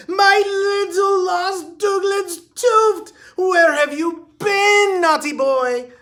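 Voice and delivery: very high, loud, slow sing-song coo; gushing rich lady. A narrator's reading. Missus Nutbush crooning over her runaway dachshund, very high and sing-song. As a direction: croon to the lost dog like a doting socialite.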